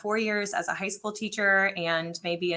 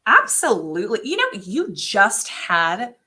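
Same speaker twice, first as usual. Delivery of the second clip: strained fake calm; 3 semitones higher, 4 dB louder than usual. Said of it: "I'm like".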